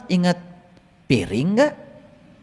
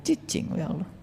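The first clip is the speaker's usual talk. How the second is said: soft, lower mock hushing; hushed; 3 semitones lower, 14 dB quieter than usual. Worshipper telling God to stay quiet, hushed mock. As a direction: shush God as if he's a nuisance.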